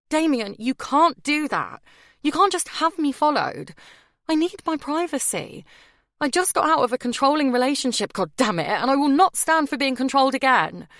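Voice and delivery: enraged refusal to be controlled; furious. A narrator's reading. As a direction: shout her independence at him. Bella furious about being followed.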